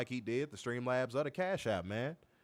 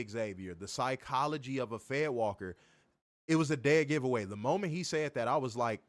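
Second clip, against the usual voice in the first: normal talking voice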